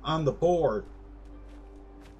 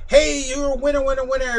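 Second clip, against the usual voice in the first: high, loud sing-song barker call; carnival barker pitch; 11 semitones higher, 5 dB louder, faster than usual. Retold or imitated. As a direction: hawk it like a fairground dart booth. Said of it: "I go through my whole rigmarole".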